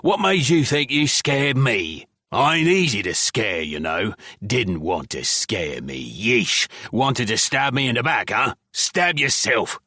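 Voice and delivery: goblin accusing Scythe of backstabbing, jeering; goblin taunting. Acted. Goblin taunting his cellmate.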